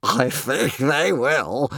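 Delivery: slow, drawn-out amused dragon cry; hugely amused creature drawl; crying with laughter. A narrator's reading. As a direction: say it slowly, overcome with mirth. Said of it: "crying with laughter".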